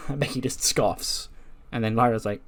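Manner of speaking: ordinary delivery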